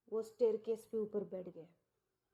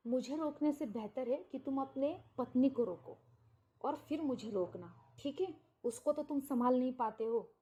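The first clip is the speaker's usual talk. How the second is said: usual speaking voice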